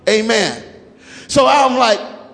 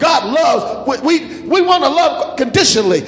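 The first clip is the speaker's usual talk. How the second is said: ringing sermon cadence, pushing each line harder; preaching at full force; 4 semitones higher than usual. Acted. Preacher rallying the congregation, in full-force preaching cadence.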